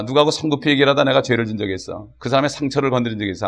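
ordinary talk

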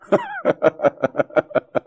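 a real burst of laughter; high burst of laughter. Real, not acted. Preacher laughs at the story.

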